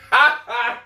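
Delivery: short high-pitched laugh. Real, not acted. Streamer amused while chatting to viewers, laughing. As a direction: burst into a brief, high laugh.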